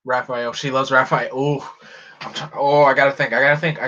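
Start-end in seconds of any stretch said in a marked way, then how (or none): none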